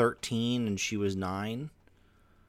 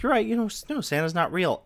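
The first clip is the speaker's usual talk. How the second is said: high mock mom admitting it; mom confessing; 9 semitones higher, 3 dB louder than usual. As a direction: blurt it out high and sheepish. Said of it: "She's like".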